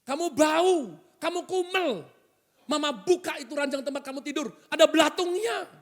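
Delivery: high, exasperated mum's scolding; acted shriek of a fed-up mother; mother yelling. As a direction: yell at the child in a high voice. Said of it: "Mama teriak".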